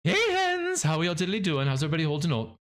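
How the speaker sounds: bright hammed-up streamer hello; Plumbella impression. Imitating Plumbella greeting her viewers, high and hammy. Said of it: "you do not wanna hear my Plumbella".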